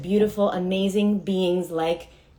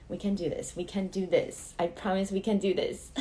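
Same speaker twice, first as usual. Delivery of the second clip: nervous self-encouragement; 3 semitones lower, 7 dB quieter than usual. Real, not acted. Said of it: "you guys can tell I'm nervous".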